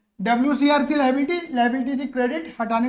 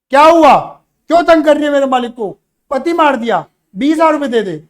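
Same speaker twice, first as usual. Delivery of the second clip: loud, indignant challenge in a story quarrel; acted angry confrontation; 8 dB louder than usual. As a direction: confront them loudly, as in a staged quarrel. (गुस्से का अभिनय, ऊँची आवाज़ में)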